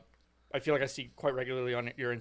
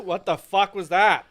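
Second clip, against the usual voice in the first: usual speaking voice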